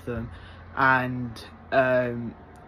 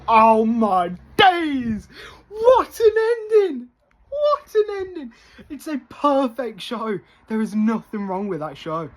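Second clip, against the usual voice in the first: ecstatic; 9 semitones higher, 5 dB louder than usual. Real, not acted. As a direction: gush like you can't contain it. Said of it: "this got me so hyped".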